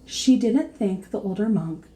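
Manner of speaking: ordinary delivery, nothing marked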